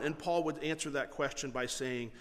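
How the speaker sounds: ordinary delivery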